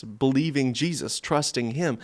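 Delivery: usual speaking voice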